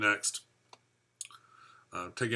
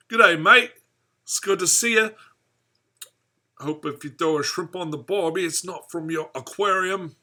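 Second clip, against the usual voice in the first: hammed-up Aussie greeting, high and loud; big, loud mock-Australian voice pitched way up; bad Australian accent; 8 semitones higher, 8 dB louder than usual. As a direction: put on a broad, badly faked Australian accent. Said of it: "try to do an Australian accent".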